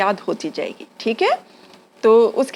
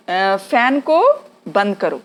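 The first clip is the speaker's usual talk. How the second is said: ordinary talk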